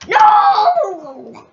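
high, loud cry of No; anguished shout. Real, not acted. Gamer yelling No as the train hits him. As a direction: yell it out as you fail.

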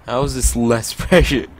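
can't keep a straight face reading it; laughing through the line. Real, not acted. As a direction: giggles while reading the line. Reading dramatic dialogue aloud.

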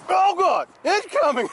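high startled yell; alarm shout. Real, not acted. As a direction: shout a sudden warning. Fish strikes suddenly.

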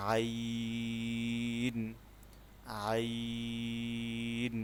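আইন drawn out very long from the middle of the throat; Arabic ain demo. Shown as a demonstration. Teacher shows students আইন from the throat, very slowly stretched. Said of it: "যেমন".

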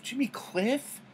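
delighted high squeal of the name; gleeful high-pitched recognition; excited. Real, not acted. Excited find in playlist.

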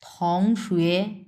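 "থং শুয়ে" drawn out slowly, each syllable in second tone. Shown as a demonstration. Teacher models the word classmate for learners, second tones. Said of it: "এইভাবে উচ্চারণ করব".

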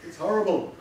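imitating someone appalled by their own recorded voice; mock horror. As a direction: act out a mildly horrified gasp and verdict. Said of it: "when you first hear your voice on a tape recorder, you go".

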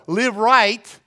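loud, high, forceful command; emphatic exhortation. Real, not acted. Preacher correcting the congregation's excuse, emphatic shout.

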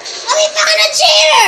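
mock alarm. Player announces he found a cheater, mock-alarmed shout.

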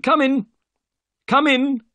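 very high, loud invitation; calling out. A narrator's reading. Unseen host calls visitors into room, high loud call. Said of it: "said the voice".

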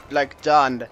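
ordinary talk, nothing marked

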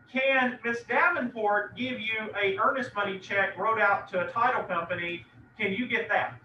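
normal talking voice

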